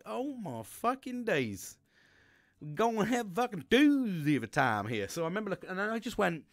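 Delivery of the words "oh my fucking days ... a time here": high, drawn-out overwhelmed exclamation; hammed-up, stretched-out disbelief; mock dismay. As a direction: retell your dread with comic exaggeration. Retold shock looking up.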